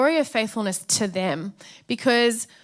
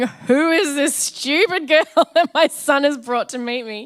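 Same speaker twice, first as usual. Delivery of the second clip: louder mock-judging mother-in-law, laughing through it; imitating Debbie; 6 semitones higher, 8 dB louder than usual. Debbie eyeing her son's city girlfriend, mock-disdainful and laughing. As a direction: play the baffled mum, half laughing. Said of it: "sitting there going".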